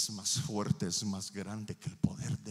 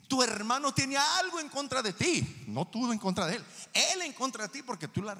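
raised, emphatic preacher's voice; forceful preaching; 14 semitones higher, 7 dB louder than usual. Real, not acted. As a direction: drive the point home to the congregation.

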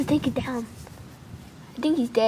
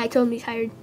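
ordinary talk

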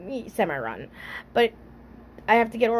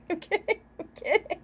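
laughing; 7 semitones higher, 8 dB quieter than usual. Real, not acted. Laughing at own joke.

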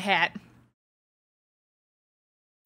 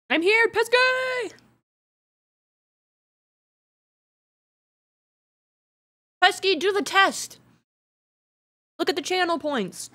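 excited call-out; 10 semitones higher, 3 dB louder than usual. Real, not acted. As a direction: cheer the viewer on. Calling out to viewer.

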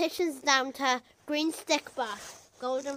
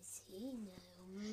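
whisper; 3 semitones lower, 21 dB quieter, slower than usual. Acted. Dramatic reveal of eviction.

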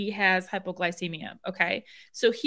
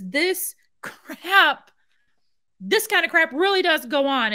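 slow, raised, fed-up; indignant; 7 semitones higher, 4 dB louder than usual. Real, not acted. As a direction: hammer home your outrage. Angry about the abuse.

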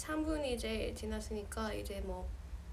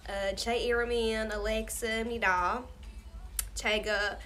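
Korean with a broad American Southern twang; Southern-accented Korean; 4 dB louder than usual. Shown as a demonstration. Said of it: "Korean in a southern accent".

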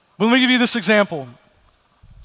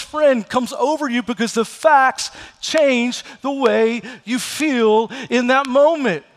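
higher, louder, slowing on "Here's the facts"; preaching at full force; 4 semitones higher, 3 dB louder than usual. Acted. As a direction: build to the sermon's peak. Preaching climax.

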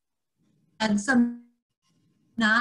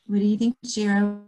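ordinary delivery

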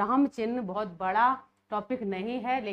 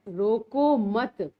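slow, louder रोको मत with no break inside; रोको मत as one unit; 5 dB louder, much slower than usual. Shown as a demonstration. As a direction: draw out रोको मत, then stop after मत. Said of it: "इसी चिन्ह को देखो हम अलग जगह लगा के".

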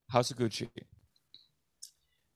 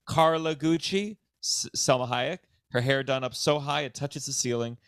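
ordinary talk, nothing marked

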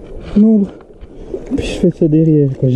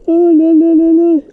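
high laughing exclamation; excited laugh; 8 semitones higher than usual. Real, not acted. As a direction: giggle with delight. Laughing at the catch.

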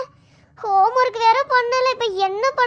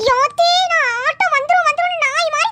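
scolding mother; 5 semitones higher than usual. Acted. Mother scolding the kids for not getting ready, nagging. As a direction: nag like a fed-up mother yelling about the auto.

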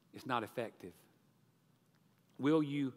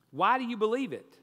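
higher, louder, insistent question; persistent questioning; 8 semitones higher, 6 dB louder than usual. A narrator's reading. Employer questioning the debtor, insistent and raised. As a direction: press him for a reason. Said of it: "persisted the employer".

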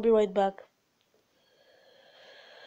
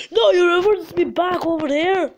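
high, loud outraged objection; raised, exasperated voice; frustrated protest; 6 semitones higher, 5 dB louder than usual. Real, not acted. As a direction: shout at the game for cheating you. Protests game moving him back.

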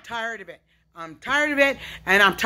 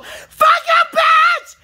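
high, loud cursing scream; raw screaming outburst; 3 dB louder, much slower than usual. Real, not acted. Lashing out at unseen tormentors, shrieking. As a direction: shriek the curse out at full force. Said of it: "This yelling and screaming".

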